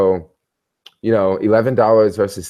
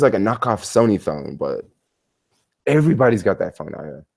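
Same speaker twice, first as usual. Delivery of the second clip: normal talking voice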